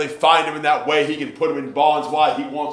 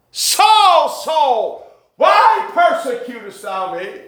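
crying out as God; 9 semitones higher, 3 dB louder, slower than usual. Acted. Same quote, now cried out high.

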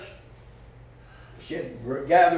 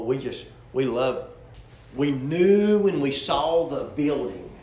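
ordinary talk, nothing marked

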